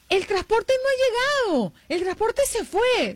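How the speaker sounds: put-on high, slow whiny girl voice; a small child near tears; upset little girl. Little sister complains to her big sister that the bus left, high and whiny.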